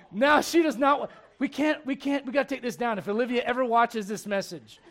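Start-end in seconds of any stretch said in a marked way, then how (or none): none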